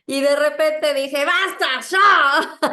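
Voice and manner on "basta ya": exclamation loud and high; grito de basta. Retold or imitated. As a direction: burst out, fed up. Explota contra la situación. (grito harto y agudo)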